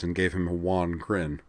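usual speaking voice

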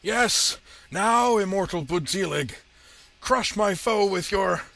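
high, very loud villainous exulting; triumphant shout. A narrator's reading. Villain orders his monster to crush the foe, triumphant shout. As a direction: crow in triumph at full volume. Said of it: "gave a triumphant laugh".

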